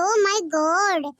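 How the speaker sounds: ordinary delivery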